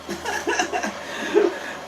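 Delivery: high, airy giggle; amused laugh. Real, not acted. Host joking about stuck nugs, amused laugh. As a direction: break into a breathy laugh.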